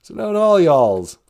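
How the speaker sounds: deep Southern voice, spoken low; Southern accent. Shown as a demonstration. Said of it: "As they would say in the South".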